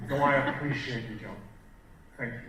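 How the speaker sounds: sincere, unhurried thanks; mean every word, leaning on "appreciate"; heartfelt gratitude with space between phrases; feeling the words. Shown as a demonstration. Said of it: "Feel your words".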